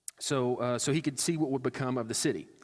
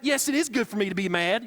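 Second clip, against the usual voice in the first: put-on higher, louder, bratty kid retort; little kid talking back; 8 semitones higher, 6 dB louder than usual. Jonah as a stubborn kid insisting he is right to be mad. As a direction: answer like a defiant child. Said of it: "a little kid responding like that".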